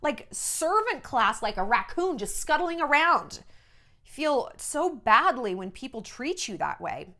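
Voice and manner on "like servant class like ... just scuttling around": high, earnest thanks; heartfelt thanks. Retold or imitated. Reporter thanking a star for treating her as human, high and earnest. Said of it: "but, like".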